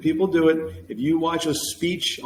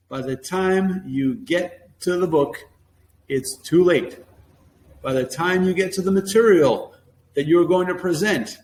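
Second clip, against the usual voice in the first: ordinary talk, nothing marked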